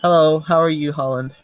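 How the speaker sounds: high, loud, over-friendly hello; emphatic fresh greeting. Acted. Restarts the greeting to Holland after rambling, emphatically friendly. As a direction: cut the rambling with a bold greeting.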